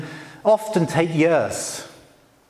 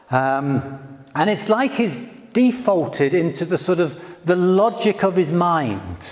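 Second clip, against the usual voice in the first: ordinary talk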